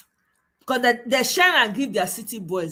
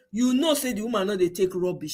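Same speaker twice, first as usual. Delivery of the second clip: ordinary talk, nothing marked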